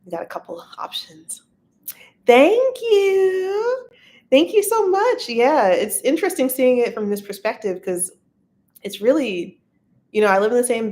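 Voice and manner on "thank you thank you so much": high, loud, drawn-out thank-you; delighted thanks. Real, not acted. Streamer thanking a live viewer for a kind comment, delighted.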